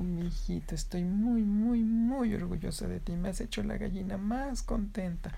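high, soft, tender mom voice; proud mother hen. Acted. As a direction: coo proudly to her little son. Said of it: "su mamá se agachó y le dijo".

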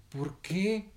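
high, rising puzzled voice; puzzled question. Acted. Heron stares at monkey mother, puzzled. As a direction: ask it high, baffled.

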